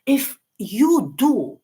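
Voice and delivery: ordinary talk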